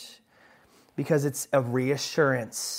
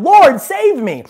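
loud, much higher desperate cry; Peter crying out; 13 semitones higher, 14 dB louder, slower than usual. Acted. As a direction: shout for rescue from the sinking. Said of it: "he cried out".

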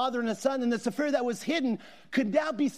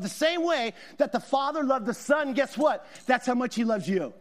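ordinary delivery

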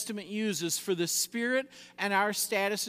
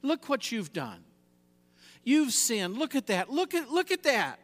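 mock accusations, climbing higher and louder; accusing Satan; 6 semitones higher, 4 dB louder than usual. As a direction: needle them as the accuser, building to a high finish. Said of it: "Satan loves to accuse".

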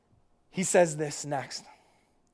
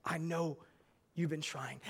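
gentle reassurance; 2 semitones lower, 12 dB quieter than usual. Retold or imitated. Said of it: "he goes".

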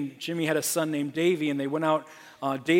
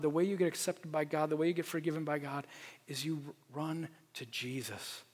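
normal talking voice

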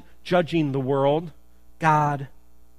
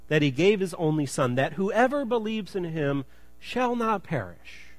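scripture reading; 2 semitones higher than usual. Acted. Pastor reads the verse to his congregation, measured and solemn. Reads John 3:16 aloud twice.

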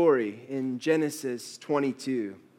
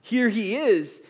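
proclaiming; 9 semitones higher, 7 dB louder than usual. Retold or imitated. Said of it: "It's screaming at us".

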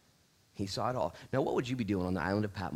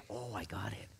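very soft, breath-only aside; whispered realization; 7 dB quieter than usual. Acted. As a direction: whisper it like a sudden insight. Imagined hushed reaction, repeated.

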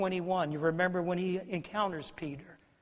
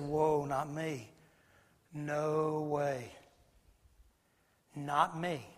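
drawn-out, lower dismissal; acted refusal; 3 semitones lower, 3 dB quieter, much slower than usual. Peter refusing Jesus's warning, mildly acted slow refusal. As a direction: imitate Peter refusing, low and unhurried.

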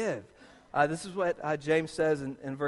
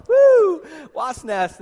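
mock eager whoop; 10 semitones higher, 11 dB louder, much slower than usual. Mock eager taking the job.

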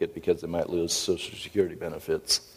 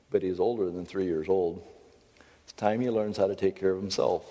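ordinary talk, nothing marked

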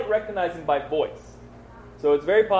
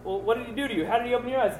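high, pressing questions, mock interrogation; pressing Pharisees; 7 semitones higher, faster than usual. Pharisees grilling the healed man, higher and pressing. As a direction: push him for how it happened.

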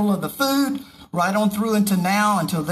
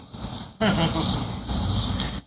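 soft breathy laugh; 5 dB quieter than usual. Real, not acted. After "one of you is a devil.

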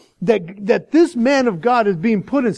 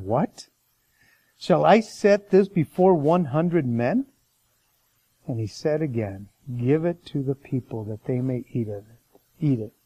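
scripture reading; 7 semitones lower than usual. Acted. Reads scripture passage aloud.

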